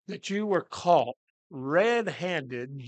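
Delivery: normal talking voice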